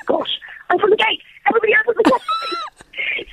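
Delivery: high-pitched mock yell; imitated frantic shouting. Guard jogging ahead shouting at other cars, imitated high yell. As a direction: call out urgently, voice shooting up high, without real volume. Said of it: "he was shouting at the other cars".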